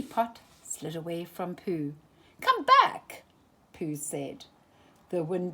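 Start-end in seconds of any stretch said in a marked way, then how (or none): 2.4-3.2 much higher, louder cry; desperate call. A narrator's reading. Pooh calling after his honey pot, high and loud. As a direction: cry after it as it slides away.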